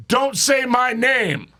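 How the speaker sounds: high, slow warning; retold warning. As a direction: stretch it out as a pointed warning. Warning the injured friend in a retelling.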